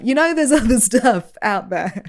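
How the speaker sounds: loud, high, laughing protest; mock passive-aggressive. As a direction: pipe up pointedly with a laugh breaking through. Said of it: "really long passive aggressive project for ten years".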